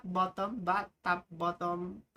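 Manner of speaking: ordinary talk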